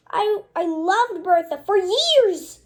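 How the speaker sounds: loud, high hurt outburst; loud high betrayed lament; heartbroken outburst. Acted. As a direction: cry out your betrayal. Character pouring out heartbreak.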